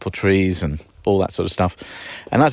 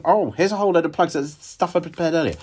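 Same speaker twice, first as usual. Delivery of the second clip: higher, breezy presenter voice, fading at the end; mocking Monty Don; 8 semitones higher than usual. To listeners, grumbling about the TV show, high mocking presenter voice. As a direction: parody a smug TV gardener. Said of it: "why doesn't he just do that instead of".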